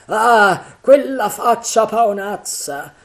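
high, loud, drawn-out exclamation; horrified outcry. A narrator's reading. Third pirate remembering Flint's face, horrified. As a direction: recoil from the memory, stretching the words. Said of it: "con un moto di raccapriccio".

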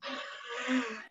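breathy hissing cockroach noise. Shown as a demonstration. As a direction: hiss like a cockroach. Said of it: "make your cockroach noise impression".

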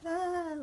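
high, restrained imitation scream; small high-pitched retold squeal; mock excited scream. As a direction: imitate a fan's scream without the volume. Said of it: "I was screaming at the television".

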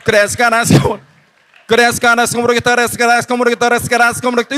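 loud, rapid droning babble; mock speaking in tongues. Youth preacher shows how his friend's tongues sounded, loud rapid chant. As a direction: chant it fast without a break. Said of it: "들리-, 들리지?".

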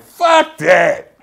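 high, loud defiant yell; defiant shout. Retold or imitated. Refusing Big Daddy's cautious advice, a loud defiant yell. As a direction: bark it back like you won't back down. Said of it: "I'm like".

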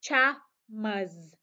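slow, drawn out "pajamas"; pajamas slowly. Shown as a demonstration. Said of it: "Chúng ta sẽ đọc là". (đọc chậm rãi từng âm "pajamas")

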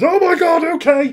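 reliving the rush of taking the controls; excited exclamation. Retold or imitated. Retelling his first moment at the controls.

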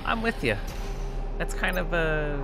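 high cutesy voice for Crix. Real, not acted. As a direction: squeal your agreement like to a pet. Cooing to the cat.

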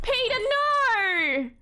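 high, long-drawn "no"; anguished cry. Acted. Gamer protesting as Peter crashes the car, anguished cry. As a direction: wail it out, stretching the last word.

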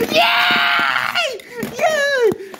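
over-the-top happy scream; squealing yay. Acted. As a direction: shriek with delight like a giddy kid. Son told he can have some.

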